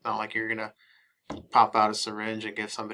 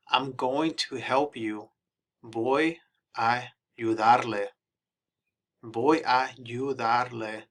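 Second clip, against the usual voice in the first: slow teaching read; slower than usual. Shown as a demonstration. Drilling phrases slowly for students.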